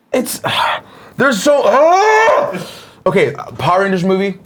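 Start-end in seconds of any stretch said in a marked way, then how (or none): 0.1-2.7 exasperated outburst. Real, not acted. Exasperated about no sequel.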